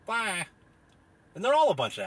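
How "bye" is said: high, airy oh-okay; mocking carefree voice; mocking naive acceptance. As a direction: parody someone blindly agreeing. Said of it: "people are just like".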